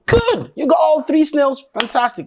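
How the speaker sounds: high, thrilled praise for his son; a father's high happy shout of praise; delighted cheer. Acted. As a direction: cheer the boy on, overjoyed. Father celebrating the last snail.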